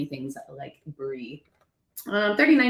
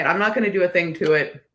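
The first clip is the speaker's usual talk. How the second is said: ordinary talk, nothing marked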